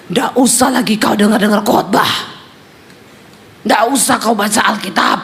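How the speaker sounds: suara orang kerasukan yang lebih rendah; suami kerasukan. Retold or imitated. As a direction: larang istri dengan suara rendah kerasukan. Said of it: "suaminya kerasukan". (suara kerasukan, rendah)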